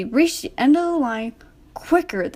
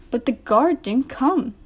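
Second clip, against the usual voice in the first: plain reading voice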